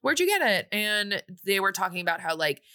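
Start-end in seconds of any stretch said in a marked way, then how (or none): 0.0-0.7 much higher, gushing friendly voice; a girl delighted to meet Courtney, gushing. Retold or imitated. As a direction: squeal over the dress like a sweet new friend. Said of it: "And she goes".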